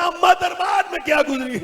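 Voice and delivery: put-on high, wailing child's question; plaintive cry. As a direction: cry out to mother in lament.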